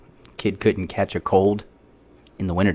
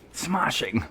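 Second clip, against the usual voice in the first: higher, softer mock British explorer; Nigel Thornberry impression; 7 semitones higher, 6 dB quieter than usual. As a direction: say it like the cartoon explorer. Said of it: "Nigel Thornberry impersonation".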